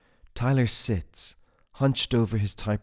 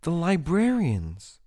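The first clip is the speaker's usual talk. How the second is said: high, slow, sure reply; more confident; 10 semitones higher, 4 dB louder, slower than usual. A narrator's reading. Jane tells Tyler who, in a high confident voice. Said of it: "more confident".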